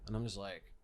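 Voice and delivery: ordinary delivery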